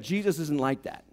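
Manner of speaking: usual speaking voice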